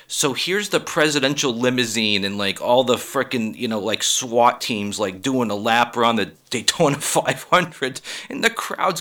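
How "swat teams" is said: ordinary talk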